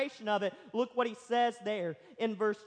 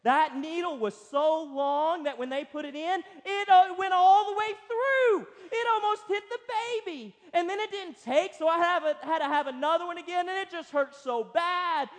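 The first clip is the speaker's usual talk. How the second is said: higher and louder, a lightly voiced lady telling a horror story; sweet little woman; 6 semitones higher, 5 dB louder than usual. A sweet older woman scaring the expectant couple, in a dramatic voice. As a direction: lightly play a church lady relishing the gory details. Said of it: "sweet little woman turns and says".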